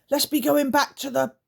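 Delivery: eager to leave; creaker voice. Acted. Scratch urges the others home, high creature voice.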